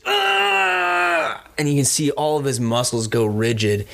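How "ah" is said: long, high-pitched acted scream; agonised scream. Game master playing a man struck by crackling energy, drawn-out scream. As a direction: hold one high cry of agony. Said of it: "And he screams".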